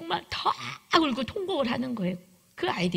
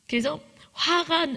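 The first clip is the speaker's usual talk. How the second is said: usual speaking voice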